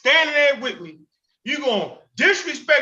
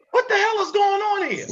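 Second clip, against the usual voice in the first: much higher, incredulous "What the hell"; exasperated high repeat; 11 semitones higher than usual. Real, not acted. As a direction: push the repeat up high in disbelief. Repeats question, higher pitch.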